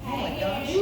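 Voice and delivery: ordinary delivery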